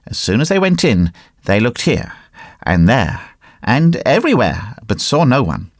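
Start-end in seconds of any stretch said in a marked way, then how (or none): none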